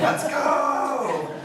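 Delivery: high, loud rallying call; rally the crowd with a bright shout; cheering them on. Retold or imitated.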